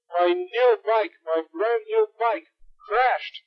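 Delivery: hammed-up grief; mock lament. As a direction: drag it out like it's a tragedy. Lamenting crashed new bike.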